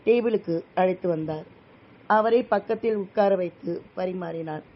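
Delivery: ordinary delivery, nothing marked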